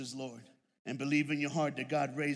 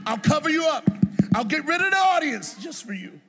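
loud, soaring preacher's voice as Jesus; Jesus promising at the door, shouting and rising; comforting Jesus; 7 semitones higher, 16 dB louder than usual. Pretend. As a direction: reassure the sinner at full force, climbing higher. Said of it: "Jesus is like".

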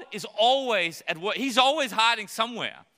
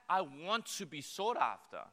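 ordinary talk

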